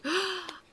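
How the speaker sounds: put-on sharp high gasp of teens caught out; mock gasp. Wife imitating scolded teens with a gasp.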